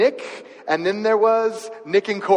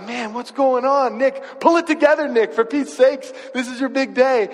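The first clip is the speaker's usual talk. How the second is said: urging the groom; 6 semitones higher, 4 dB louder than usual. Retold or imitated. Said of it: "And I'm like".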